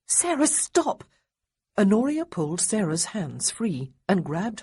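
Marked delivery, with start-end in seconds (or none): 0.1-1.0 urgent. A narrator's reading. Honoria cuts Sarah off.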